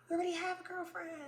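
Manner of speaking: soft, high, fast whine; sudden tiny wounded voice after shouting; mock whimpering. Realizing he already had a girlfriend, whimpering.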